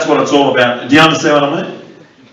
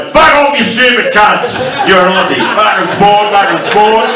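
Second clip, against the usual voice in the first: broad Aussie shout; 8 semitones higher than usual. Shown as a demonstration. Comic imagining the citizenship ceremony oath, shouted broad Australian. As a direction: bellow it like a proud Aussie yob. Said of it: "walk in and say".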